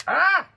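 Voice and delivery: sharp yelp. Real, not acted. Sudden reaction while eating.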